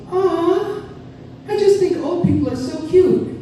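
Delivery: high-pitched mock gushing; cooing coworker. Coworker watching an elderly man, high mock cooing. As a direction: coo it in an exaggerated high voice. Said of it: "And she goes".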